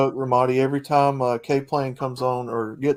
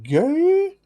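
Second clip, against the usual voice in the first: mock disbelief; 3 dB louder than usual. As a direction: squeal it like you can't believe it.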